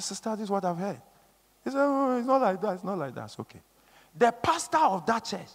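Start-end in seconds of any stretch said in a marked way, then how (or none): 0.0-3.6 soft, falling away to very quiet; retold hushed exchange. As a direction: retell it under your breath. Retold private confrontation on a call.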